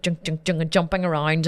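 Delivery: ordinary talk, nothing marked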